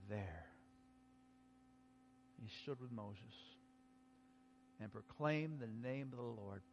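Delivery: scripture reading. Acted. Preacher reading Exodus softly to the congregation. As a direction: read the passage hushed and reverent.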